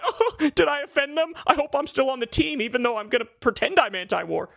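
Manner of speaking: high, laughing mock fretting; timid coward voice. Mocking timid anti-war right-wingers in a high whine. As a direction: whine like a scared tag-along, laughing. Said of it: "maga looks at you the wrong way".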